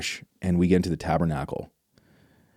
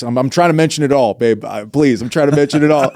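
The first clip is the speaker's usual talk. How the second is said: louder, higher pleading protest; exasperated plea; 5 semitones higher, 5 dB louder than usual. Retold or imitated. Husband pleading with his wife, loud exasperated protest. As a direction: insist you're covering everything, half laughing.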